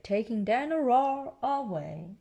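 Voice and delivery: ordinary delivery, nothing marked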